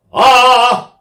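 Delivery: loud high ah, slow; accent with same lean. Shown as a demonstration. Singer demonstrating how to hold the lean, loud ahs. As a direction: keep the lean on each ah. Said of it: "maintain the same lean".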